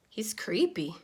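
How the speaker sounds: whispered, half to himself; a scared aside whispered so he won't hear; creeped-out whisper. Acted. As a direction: confide it in a hushed whisper. Fish confides about creepy tankmate.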